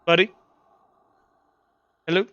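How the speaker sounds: ordinary talk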